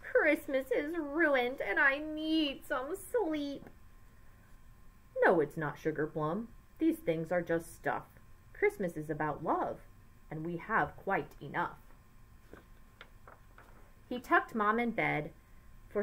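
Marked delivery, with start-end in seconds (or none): 0.0-3.7 weeping. A narrator's reading. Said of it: "Mom started to weep". 5.2-11.8 comforting dad. A narrator's reading.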